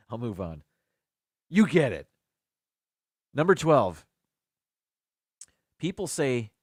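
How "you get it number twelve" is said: ordinary talk, nothing marked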